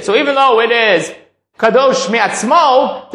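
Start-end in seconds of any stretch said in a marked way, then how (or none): 1.6-3.1 slow and emphatic, pushed out. Shown as a demonstration. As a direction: lean hard on kadosh mi'atzmo, drawing it out. Stressing the key point in class.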